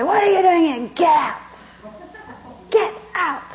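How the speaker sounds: high, loud indignant yell; acted angry shout. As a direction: throw the student out, hammed-up outrage. Teacher throws out disciple in story.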